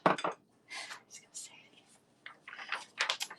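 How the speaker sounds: slow unvoiced whisper aside. Real, not acted. Muttering to herself about the habit, whispered. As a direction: breathe it out slowly like a secret.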